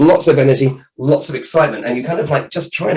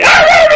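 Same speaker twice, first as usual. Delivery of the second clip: put-on very high, loud scared little-boy shriek; child's scream; 10 dB louder than usual. Boy under the bedclothes shrieks at his dad. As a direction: shriek like a terrified kid.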